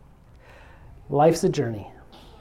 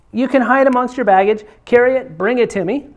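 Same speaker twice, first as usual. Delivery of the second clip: ordinary delivery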